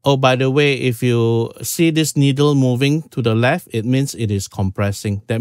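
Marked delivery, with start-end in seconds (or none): none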